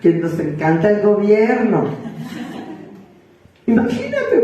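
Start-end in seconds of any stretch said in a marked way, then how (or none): 3.7-4.4 high, excited squeal on the word. Real, not acted. Preacher marvels to the congregation, excited. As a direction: burst out in delight.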